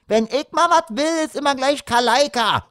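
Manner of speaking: put-on very high, aggrieved girl's squawk; griping daughter. A narrator's reading. Daughter complaining she never gets her way, griping. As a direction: carp on in a high child's voice. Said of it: "meckerte die Tochter".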